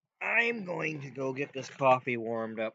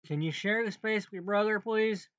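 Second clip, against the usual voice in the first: scolding mom; 9 semitones higher, 6 dB louder than usual. Pretend. Parent voiced scolding kids.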